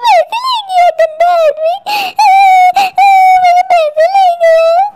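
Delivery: slow, drawn-out tearful wail. Acted. Robbed woman crying after the thief, slow wailing. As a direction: wail over stolen money, dragging the words.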